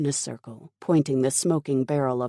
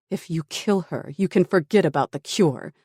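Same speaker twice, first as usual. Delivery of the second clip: raised, higher-pitched threat; defiant cry; 4 semitones higher, 6 dB louder than usual. A narrator's reading. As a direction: push back in desperation, growing louder. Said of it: "Tara cried".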